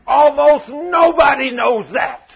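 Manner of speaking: high, loud, slow snarling viper-like demon shout. Retold or imitated. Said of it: "And she snarled and rolled her tongue out".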